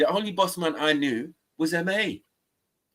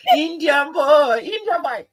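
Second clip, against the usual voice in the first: much higher, drawn-out mocking voice; 12 semitones higher, 6 dB louder, slower than usual. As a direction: squeak Indian boy like a caricature. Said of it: "I can't even do your voice".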